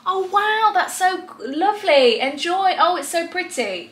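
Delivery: high, over-eager praise; fake enthusiasm. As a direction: pretend to be thrilled. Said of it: "he should be like".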